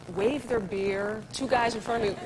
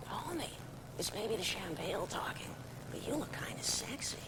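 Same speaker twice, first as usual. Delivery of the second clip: Marge Simpson impression; 10 dB quieter than usual. Shown as a demonstration. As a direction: bring the Marge voice down, almost whispered. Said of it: "And that was Marge".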